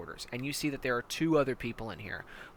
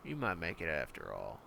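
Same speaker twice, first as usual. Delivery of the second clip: much lower, softer grudging praise; grudging approval; 12 semitones lower, 10 dB quieter than usual. Acted. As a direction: let it slip low and quiet.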